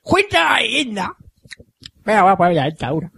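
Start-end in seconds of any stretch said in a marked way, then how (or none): 0.0-1.1 lightly voiced old grandma; a hint of an old grandma. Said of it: "con la voz de abuela".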